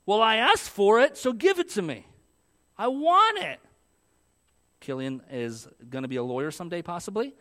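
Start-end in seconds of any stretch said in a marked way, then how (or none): 0.0-3.6 put-on high, loud whiny little kid insisting; demanding toddler. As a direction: demand it like a stubborn three-year-old. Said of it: "this is the attitude my three-year-old has".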